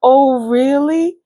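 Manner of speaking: high, slow, sceptical rise; mock incredulous oh really; doubtful oh really. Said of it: "you're like".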